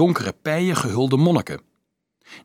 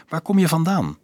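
higher-pitched character question; 7 semitones higher than usual. A narrator's reading. Dolf asks the girl where she is from, questioning. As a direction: lift the pitch to ask.

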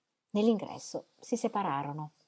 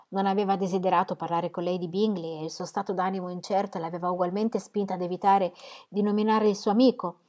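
usual speaking voice

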